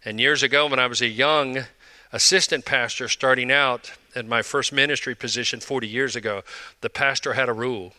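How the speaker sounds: usual speaking voice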